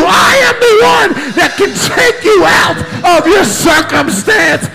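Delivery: whooping preacher climax; full-force preaching. Acted. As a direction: preach it as Christ calling out.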